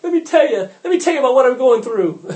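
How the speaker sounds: high, loud, insistent pleading; hammed-up begging to be heard; mock urgent prayer. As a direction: parody someone informing God of their troubles. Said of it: "You do not have to let God know".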